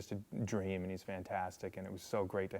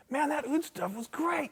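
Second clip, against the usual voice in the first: high, loud, slow caricature; 5 dB louder than usual. Retold or imitated. Drummer praising the finished record, squeaky mock enthusiasm. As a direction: crow it with exaggerated enthusiasm. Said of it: "he's like".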